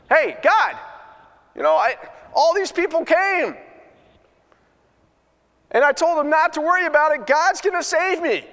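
rising to a very high, bewildered question; high baffled plea; puzzled complaint. Retold or imitated. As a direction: ask God what went wrong, baffled. Man questions God in heaven.

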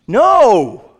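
a high, loud, flat refusal; No. shouted high and hard as God's refusal; emphatic no. Acted. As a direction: slam the door with one word, No. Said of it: "An emphatic no".

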